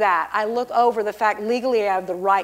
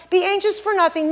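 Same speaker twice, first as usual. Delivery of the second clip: higher-pitched, tossed-off version of the verse; breezy brush-off; 6 semitones higher, faster than usual. Retold or imitated. The misquote of God's word, breezy brush-off. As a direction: rattle the verse off lightly, without weight. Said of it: "He didn't say".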